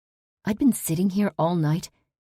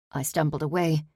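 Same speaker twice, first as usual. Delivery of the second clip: ordinary delivery, nothing marked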